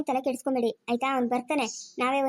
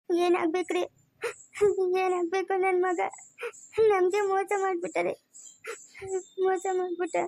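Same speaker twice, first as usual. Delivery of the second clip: higher-pitched sobbing lament; crying; 4 semitones higher than usual. Acted. Mother laments to her husband that her son betrayed them, crying. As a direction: weep out the hurt, pausing for sobs. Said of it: "ಈಗ ನೋಡು ಅಳ್ತಾ ಇರೋದು".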